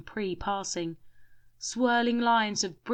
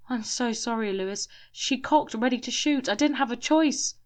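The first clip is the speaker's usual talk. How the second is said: apologetic; 5 semitones higher than usual. A narrator's reading. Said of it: "said Matthews".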